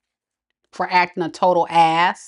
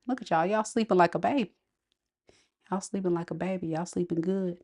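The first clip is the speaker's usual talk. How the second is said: much softer, as if over a sleeper; hushed cooing; 10 dB quieter, faster than usual. Real, not acted. Reader watching you sleep like a baby, hushed. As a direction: drop to a near murmur.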